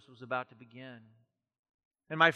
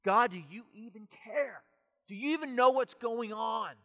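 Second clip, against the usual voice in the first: raised, pleading cry, a touch louder; mock anguished cry at God; 6 semitones higher, 5 dB louder than usual. Driver pounding the dashboard, crying out to God, a touch louder. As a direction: reenact shouting at God from the car, not full force. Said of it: "crying, screaming at God".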